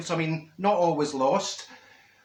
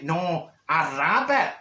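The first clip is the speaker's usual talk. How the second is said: slow correction; much slower than usual. Retold or imitated. Minnie correcting slowly.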